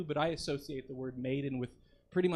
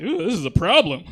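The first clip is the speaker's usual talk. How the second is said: loud mock alarm; 4 semitones higher, 12 dB louder than usual. Sex shop customer inner voice.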